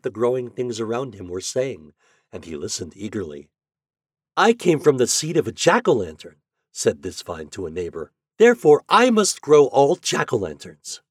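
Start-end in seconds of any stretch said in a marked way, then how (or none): 4.4-6.4 higher, louder storybook voice for a vine; lightly voiced vine telling a neighbor proudly of its seed; talking vine. A narrator's reading. 8.4-11.0 lightly voiced storybook vine, higher and louder; talking vine. A narrator's reading.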